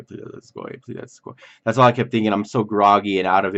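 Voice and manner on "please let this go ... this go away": soft whispered begging in pain; a hushed plea for the pain to go away; pleading whisper. Retold or imitated. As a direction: whisper it like a desperate prayer. Pain after surgery, praying.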